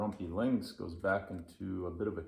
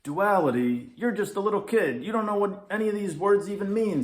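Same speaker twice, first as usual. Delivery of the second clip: much higher, loud, fast scoffing; grumpy lynx; 11 semitones higher, 9 dB louder, faster than usual. Acted. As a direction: scoff at the kid in disbelief. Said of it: "says the Grumpy Lynx".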